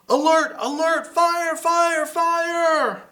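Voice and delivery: high, loud urgent alarm cry; urgent alarm call. Acted. Acting the alarm in the story.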